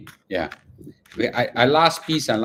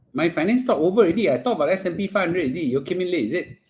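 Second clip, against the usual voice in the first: fast, higher teasing aside; quick aside; 6 semitones higher, faster than usual. Real, not acted. Telling latecomer the finance talk is over, fast and high.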